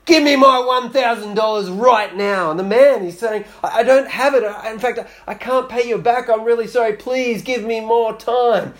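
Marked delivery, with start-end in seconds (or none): 0.0-2.5 loud, higher demanding yell. Acted. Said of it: "yells at him". 3.6-8.7 higher, stammering plea; pleading debtor. Acted. Debtor pleading for more time.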